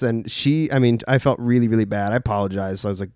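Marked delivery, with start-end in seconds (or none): none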